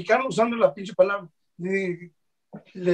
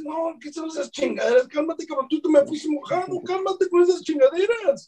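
mock scolding, pitched much higher; retold scolding; 8 semitones higher than usual. Retelling how he scolds a fellow Mexican, high mock scold. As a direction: tell him off, playacting the anger.

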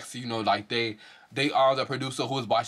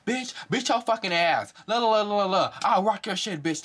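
mock scripted taunt; 8 semitones higher than usual. Vlogger mocking a cast member's scripted-sounding fight taunt. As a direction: parody the cast member's stilted threat. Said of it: "sounds scripted as fuck".

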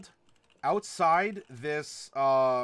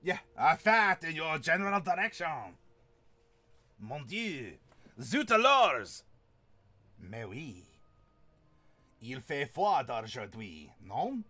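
hammed-up French accent, swinging from big cries to low asides; outrageous French accent; 4 semitones lower than usual. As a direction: play a comic Frenchman. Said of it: "with an outrageous French accent".

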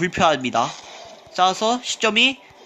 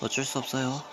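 normal talking voice

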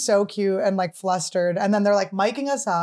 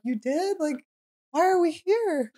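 confused question; 7 semitones higher than usual. Retold or imitated. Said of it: "We're like".

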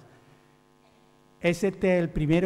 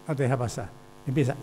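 usual speaking voice

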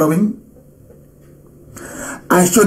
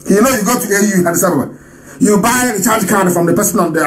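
ordinary talk, nothing marked

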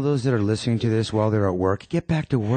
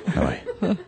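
laughing; 10 semitones higher than usual. Acted. Janitor laughing through greeting.

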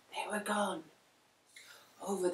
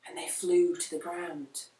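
ordinary delivery

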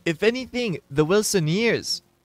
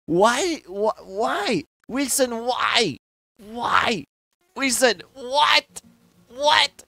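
higher, plaintive mock protest; hammed-up hurt pleading; whining complaint; 6 semitones higher than usual. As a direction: wail it like a wronged junior. Mock complaint about Wilson's meanness.

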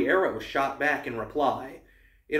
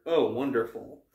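usual speaking voice

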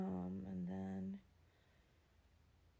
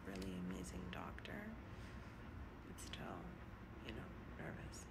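soft nervous whisper; hushed, barely voiced; 12 semitones lower, 7 dB quieter than usual. Real, not acted. Nervous patient whispering in clinic.

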